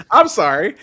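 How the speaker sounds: usual speaking voice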